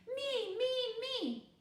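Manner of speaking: high eager chorus of me me me; kids calling out. A narrator's reading. Classmates clamoring to be picked next, eager high calls. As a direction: beg to be chosen. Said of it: "was calling out now".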